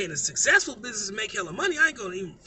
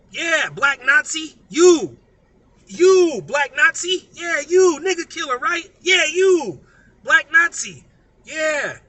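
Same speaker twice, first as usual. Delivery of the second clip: loud scornful shout, pitched up; 4 semitones higher, 11 dB louder, slower than usual. Real, not acted. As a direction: yell the accusation at him. Scolding, calling listener names.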